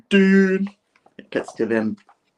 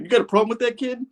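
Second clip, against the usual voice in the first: much higher challenging voice; mock tough [name]; faster than usual. [name] challenges the baker, high and taunting. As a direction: challenge the kid.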